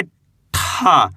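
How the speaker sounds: retroflex t in "ठा" with a long puff; drawn-out, aspirated "ठा"; letter ठा demo. Shown as a demonstration. Teacher reading out ठा for beginners, letter demo. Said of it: "इसको अपन पढ़ेंगे".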